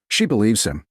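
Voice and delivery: ordinary delivery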